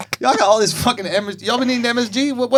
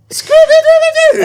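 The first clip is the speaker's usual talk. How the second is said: much higher, loud laughing yell; laughing shout; 9 dB louder than usual. Shown as a demonstration. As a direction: scream it out cracking up. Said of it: "how do I say it?".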